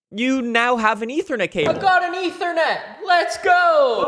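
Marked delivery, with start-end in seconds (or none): 1.8-4.0 high, excited victory shout; triumphant cheer. Real, not acted. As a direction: cheer like you won. Cable works.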